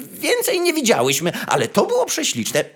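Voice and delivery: high, chirpy sparrow chatter in quick bursts; chirping sparrows. A narrator's reading. Sparrows eagerly chirping their news to the young fir tree, high and chirpy. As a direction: twitter it out like eager little birds.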